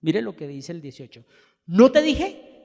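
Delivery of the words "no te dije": loud, higher ¿No te dije? shot out; exclamation. Retold or imitated. King Acab exclaiming to Josafat, loud and higher. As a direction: exclaim it in vindicated triumph. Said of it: "exclamó el rey".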